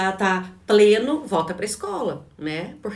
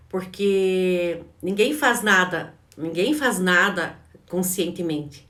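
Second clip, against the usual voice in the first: slow emphasis; slower than usual. Real, not acted. Coach stressing a point.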